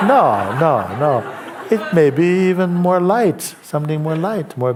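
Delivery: high, loud laughing protest. Real, not acted. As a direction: laugh it away, delighted. Master laughing off the doubt.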